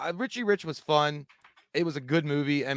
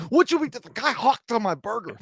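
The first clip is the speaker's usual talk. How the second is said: indignant character voice; 9 semitones higher than usual. Retold or imitated. Friend quoting the diner line, high and indignant. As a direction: protest in a squeaky voice. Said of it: "He's like".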